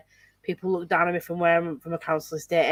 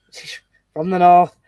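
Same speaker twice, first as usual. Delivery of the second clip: north said broad and louder, Yorkshire vowels; broad northern accent; 9 dB louder than usual. Shown as a demonstration. Telling friends where the girl is from, broad Yorkshire. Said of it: "From, like, my north".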